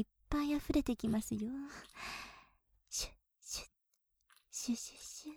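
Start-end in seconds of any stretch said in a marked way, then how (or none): 2.9-5.3 soft breathy swishing sound of a brush; whispered brush swishes. Acted. Maid voicing the brush for her master, whispered swishes. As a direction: hiss the brush strokes softly.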